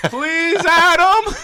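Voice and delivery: very high, loud mock wail; mock pleading. Players begging the league boss for mercy, mock pleading. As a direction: beg at the top of your voice, hammed-up.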